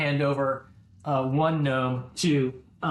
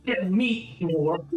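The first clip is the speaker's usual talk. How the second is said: dragged gnome insult; 3 semitones higher than usual. Acted. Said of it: "He's like this".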